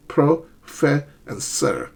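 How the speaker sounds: "pro, fe, and sir" broken apart with pauses; syllable by syllable. Shown as a demonstration. Teacher showing the three syllables, "pro, fe, and sir" said separately. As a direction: count out each syllable separately. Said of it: "there are three".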